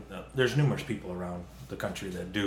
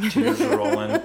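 squealing laugh; 10 dB louder than usual. Retold or imitated. Laughing retelling of whip injuries.

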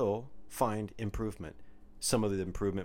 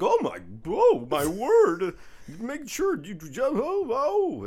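high, loud mock-horror wailing; hammed-up distraught lady; way too animated; 12 semitones higher, 8 dB louder, much slower than usual. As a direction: overreact wildly, voice way up high. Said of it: "she was way too animated".

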